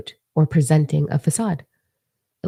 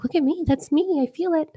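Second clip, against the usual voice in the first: much higher, sing-song self-mockery; mock giddy self; 12 semitones higher, 4 dB quieter than usual. Mocking herself commenting on tarot videos, high. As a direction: squeal it like a smitten fan.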